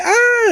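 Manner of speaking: short, very high falling-woman scream; imitated scream. Podcaster mimicking a woman falling off the cliff, imitated scream. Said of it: "she's screaming".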